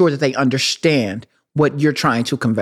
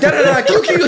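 louder, higher, laughing call; laughing, raised mock call to a friend; eager calling out; 8 semitones higher, 4 dB louder than usual. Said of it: "I'd be like".

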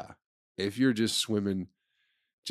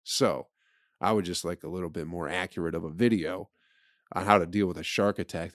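ordinary talk

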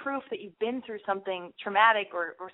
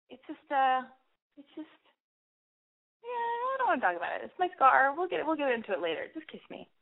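higher, hesitant, coy voice; coy deflection; 6 semitones higher than usual. Acted. Woman putting off a date's question, coy and high-pitched.

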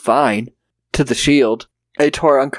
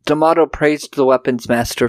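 ordinary talk, nothing marked

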